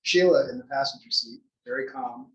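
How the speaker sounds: ordinary talk, nothing marked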